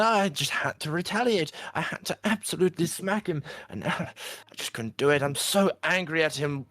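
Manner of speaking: mother justifying hitting someone, high and upset; Martha upset. Acted. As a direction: blurt out a guilty confession. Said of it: "And she go".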